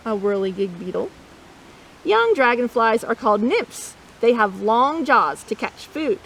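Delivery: plain reading voice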